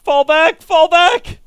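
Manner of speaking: high, loud shouted retreat order; frantic retreat order. Real, not acted. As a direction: yell at troops to fall back.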